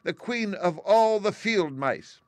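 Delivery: high, loud, squeaky proclamation; indignant mouse queen. A narrator's reading. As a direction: announce royal rank in an offended squeak, slowing grandly. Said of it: "cried the little animal indignantly".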